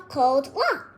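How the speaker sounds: plain reading voice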